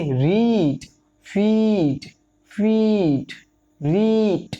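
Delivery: रीड, फीड with the ee stretched slowly; slow word list, each ee sustained; long drawn-out vowel. Shown as a demonstration. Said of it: "लंबी खींच रही sound".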